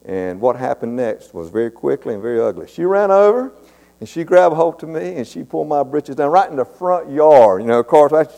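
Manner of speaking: ordinary talk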